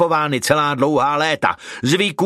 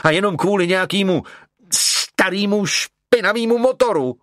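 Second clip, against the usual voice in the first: put-on higher, fast and prickly, offended girl; touchy; 7 semitones higher than usual. A narrator's reading. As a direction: snap it out, quick and piqued. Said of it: "řekla nedůtklivě". (dotčeně, rychle a výš)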